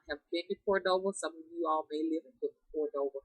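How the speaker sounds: ordinary delivery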